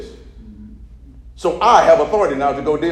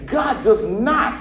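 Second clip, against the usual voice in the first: slow call to repeat; 6 semitones higher, much slower than usual. Shown as a demonstration. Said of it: "say God does not".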